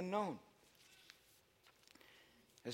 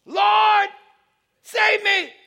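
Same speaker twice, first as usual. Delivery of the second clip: high loud plea held long; desperate cry; 14 semitones higher, 14 dB louder, much slower than usual. Acted. Pastor acting Peter's plea to the Lord, desperate cry. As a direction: cry out for rescue at full voice.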